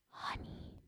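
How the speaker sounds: low secret whisper; deep whisper. A narrator's reading. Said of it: "said in a deep whisper".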